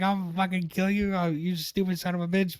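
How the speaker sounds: mock furious dad. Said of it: "he was just like".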